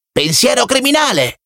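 very high taunting yell; children shouting. A narrator's reading. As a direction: shriek it as a playground taunt. (grido stridulo di scherno)

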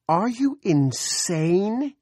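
very high, loud, drawn-out cry of disbelief; outraged scream. A narrator's reading. Woman to the men warning of a missile, screamed disbelief. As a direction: scream it slowly at a high pitch. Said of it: "Carolyn screamed".

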